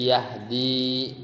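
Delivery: "yahdi" with the final i held long; long final vowel. Shown as a demonstration. Teacher shows the ya reappearing, long final i. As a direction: draw out the last i of "yahdi". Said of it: "ya-nya muncul".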